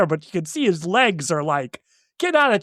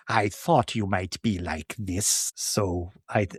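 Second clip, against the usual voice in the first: sinister Pretorius; 3 semitones lower, slower than usual. Retold or imitated. Host voicing the sly villain threatening the baron, low. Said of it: "Pretorius is like".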